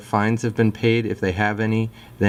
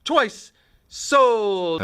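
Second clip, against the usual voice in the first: auctioneer voice; much slower than usual. Retold or imitated. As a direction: call out the final bid. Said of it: "so I say, you know".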